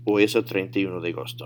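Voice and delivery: quick, run-together Spanish answer; fast fluent demo; natural speed. Shown as a demonstration. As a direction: say "Hoy es el treinta y uno de agosto" briskly in one go. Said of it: "say them at natural speed".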